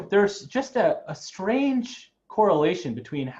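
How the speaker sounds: ordinary delivery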